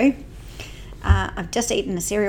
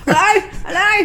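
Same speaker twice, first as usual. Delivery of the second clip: mock high greeting; 11 semitones higher, 7 dB louder than usual. Mimicking the co-host's greeting.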